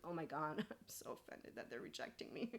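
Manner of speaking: hushed, mock-wounded aside; mock offended. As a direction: sneer it under your breath. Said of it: "and think".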